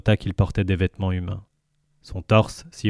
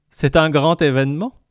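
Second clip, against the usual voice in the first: higher alien woman voice; character voice; 7 semitones higher, 4 dB louder than usual. Acted. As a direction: voice the amused crewmate. Sissix voice.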